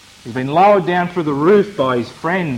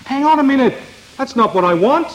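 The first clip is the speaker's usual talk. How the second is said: mock protest; 9 semitones higher, faster than usual. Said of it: "any protest recorded upon the man's lips".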